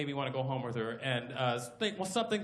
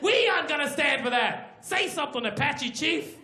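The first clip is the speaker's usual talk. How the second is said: urging on; 9 semitones higher than usual. Acted. Hero urges Apache Chief.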